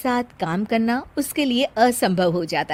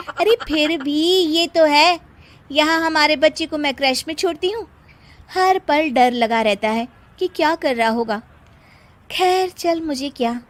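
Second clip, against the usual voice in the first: friend's voice; 5 semitones higher, 3 dB louder than usual. Acted.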